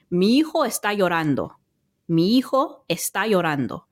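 Spanish in a jumbled-up but natural accent; Spanish with fluent native-like vowels and tapped r; own Spanish accent. Shown as a demonstration. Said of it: "the way that I would say it in my jumbled up accent".

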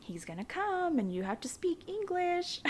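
high, coaxing imitation of mom; mom prepping her child. Aunt-mom coaching her six-year-old, in a high coaxing mock voice. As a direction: lightly mimic a mom coaching her kid. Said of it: "my mom prepping me".

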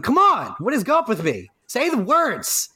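high-pitched, comic frustration at a flub; worked-up, squeaky-high exasperation; exasperated with oneself. Real, not acted. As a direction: scold yourself in high, exasperated bursts. Berating self after flubbing script.

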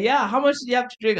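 high, quick questioning; imitated mother. Mom questioning her drunk son, imitated high quick voice. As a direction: interrogate in a fast, high mock voice.

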